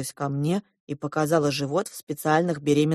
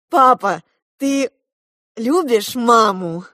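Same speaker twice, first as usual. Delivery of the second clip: loud, raised, slow solemn question; 5 semitones higher, 7 dB louder, slower than usual. A narrator's reading. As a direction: ask it as a solemn demand. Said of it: "торжественно сказала я". (торжественно, громко и медленно)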